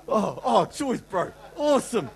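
loud, high, over-bright church-door cheer; hammed-up cheery greeter. Church greeter to a struggling visitor, with mock cheer. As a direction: beam it like nothing's wrong.

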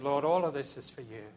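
soft, low, reverent aside; hushed, low re-enacted prayer; quiet prayer. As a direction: offer it up to God under the breath. Hushed prayer retold at emotional climax.

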